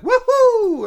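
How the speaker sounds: very high, loud cartoon plumber whoop; Mario cheer. Host mimics Mario's cheer from the movie, very high and loud. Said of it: "he'd still do".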